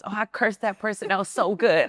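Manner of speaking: lightly voiced Southern country friend; country accent. Imitating her country friend Dearica, a light Southern accent. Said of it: "country kinda accent".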